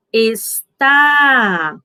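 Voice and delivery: está with a strong, long TÁ; teacher's demo, es-TÁÁ; stress on last syllable. Shown as a demonstration. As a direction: put the force on the TÁ of está. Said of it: "com mais intensidade".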